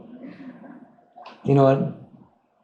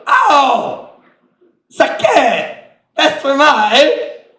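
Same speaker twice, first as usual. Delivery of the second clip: loud, high mock cry of hurt; pained protest; 10 semitones higher, 5 dB louder, much slower than usual. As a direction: yelp and complain as if just jabbed. Acts out pricked listener complaining.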